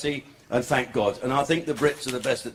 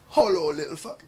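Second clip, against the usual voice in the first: put-on Jamaican-accented cheeky reply. Chalky answers her proposition, Jamaican accent. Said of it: "This is my Jamaican accent now".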